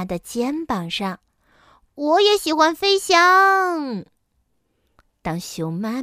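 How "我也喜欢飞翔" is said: high, loud, drawn-out cub voice; excited little bear. A narrator's reading. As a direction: declare it slowly with childlike glee. Little bear gleefully joins in.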